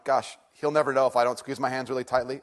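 normal talking voice

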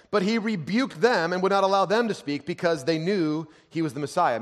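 high, loud scripture proclamation; full-force preaching. Acted.

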